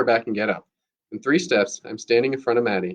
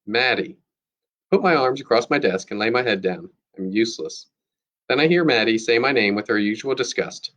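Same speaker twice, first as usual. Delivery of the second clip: plain reading voice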